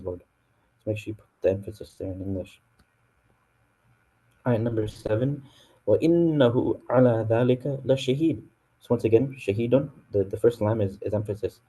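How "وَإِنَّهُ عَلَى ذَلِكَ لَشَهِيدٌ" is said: fast, melodic Quranic recitation; chanted Arabic verse recitation. Shown as a demonstration. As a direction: recite the verse in tajweed style. Reciting ayah seven in Arabic.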